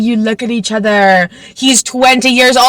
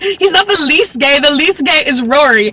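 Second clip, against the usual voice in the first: laughing retort; 5 semitones higher than usual. Acted. Voicing friend's retort, laughing.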